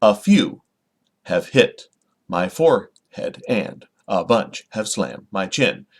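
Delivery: "a few", "have hit" broken into two-beat feet, second word punched; slow, choppy iambs: "a FEW... have HIT... my FORE..."; unstressed-stressed. Shown as a demonstration. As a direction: pause after each foot of "and a bunch have slammed my chin". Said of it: "an unstressed syllable with a stressed syllable".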